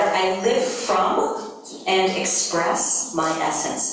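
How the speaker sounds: slow, deliberate affirmation; measured dictation voice; slow statement. Shown as a demonstration. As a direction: read it out slowly for them to write. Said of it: "where it says water".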